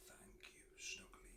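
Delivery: soft whispered thanks. A narrator's reading. Noisy thanking Snuggly for the hug, whispered. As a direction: barely breathe the thanks. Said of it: "he whispered".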